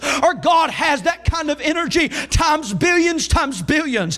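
driving, high-pitched sermon delivery; Southern revival preacher in full flow; full-force preaching. Real, not acted. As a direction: preach it with rising intensity.

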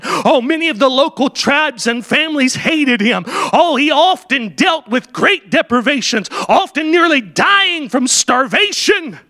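rising sermon fervour; preaching. Acted. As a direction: build the hardships for the congregation. Preaching Livingston's hardships.

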